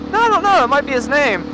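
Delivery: high, loud protest, imitating; imitated wifely objection. Voices wife's reply.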